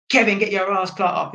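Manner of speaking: mock parental wake-up call; dad waking son. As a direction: act out rousting a sleeping son. Imagined dad bursting in to wake son.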